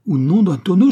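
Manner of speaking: loud, high angry oath; exasperated threat. A narrator's reading. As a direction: explode at the pest. Enraged threat to silence the nag.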